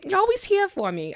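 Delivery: high, loud burst of joy; thrilled. Acted. Party member squealing with joy at the unlocked iPad. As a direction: squeal it out, overjoyed.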